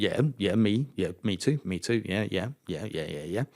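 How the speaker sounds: soft, low string of agreement, fading away; feigned casual agreement. Retold or imitated. Rookie pretending to match his teammate, quietly agreeing. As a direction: play along, letting it tail off quietly.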